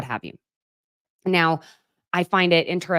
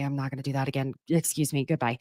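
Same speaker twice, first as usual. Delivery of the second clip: usual speaking voice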